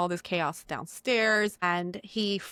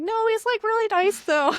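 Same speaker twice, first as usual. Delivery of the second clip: much higher, sing-song girly mock voice; mocking Belle; 14 semitones higher, 5 dB louder than usual. Belle defends the beast to the townsfolk, in a high mocking squeak. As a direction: squeak it out like a naive princess.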